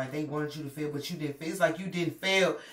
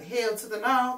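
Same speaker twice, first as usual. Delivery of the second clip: sassy refusal; 7 semitones higher, 6 dB louder, slower than usual. Acted. Emphatic refusal.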